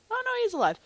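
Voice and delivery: high, loud exclamation; surprised outburst. Real, not acted. Player finds Lighter still alive, loud high surprise. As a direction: blurt it out in mock alarm. Mock alarm at game event.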